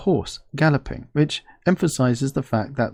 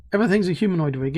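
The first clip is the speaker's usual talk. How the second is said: normal talking voice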